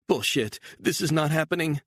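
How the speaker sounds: high, alarmed cry; panicked woman. A narrator's reading. Woman seeing the pursuers in the mirror, high and panicked. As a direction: spot them and panic. Beatrice voiced by narrator.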